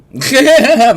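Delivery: loud, high burst of laughter at his own slip. Real, not acted. Streamer caught spoiling it for chat, loud laughter.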